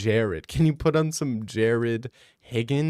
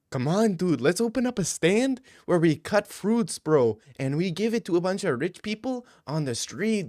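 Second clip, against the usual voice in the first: higher, excited buddy voice throughout; a higher, hammed-up Mexican dude impression; mock Mexican bro; 5 semitones higher than usual. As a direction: hype a friend up to go get tacos. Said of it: "You're hearing them talk like".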